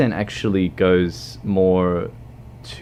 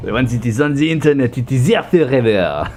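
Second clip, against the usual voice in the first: higher, louder mock French accent; 5 semitones higher, 4 dB louder than usual. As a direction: declare it in a hammy French accent. Back into stereotype French accent.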